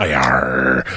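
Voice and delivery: low pirate growl. Pretend. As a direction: growl it like a sea dog. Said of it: "do your pirate talking".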